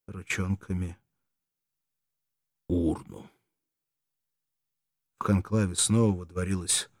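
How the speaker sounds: ordinary delivery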